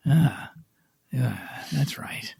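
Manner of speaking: whispered Al Pacino; breathy hushed gangster rasp, like an old mob boss; Al Pacino impression. Host does his Al Pacino for the cohost. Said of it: "That's my Al Pacino".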